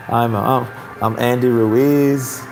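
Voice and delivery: slow, hesitant mellow fighter; Andy Ruiz. Acted. Host mimics the deceptively harmless fighter, mellow and slow. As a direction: drawl the name humbly, drawn out. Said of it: "talking like that".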